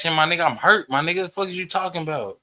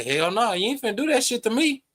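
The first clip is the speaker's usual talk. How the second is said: a high, fast, defiant cry; 8 semitones higher than usual. Retold or imitated. A hint of defiance, refusing to be wronged. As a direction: push back hard and fast. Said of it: "when a motherfucker say".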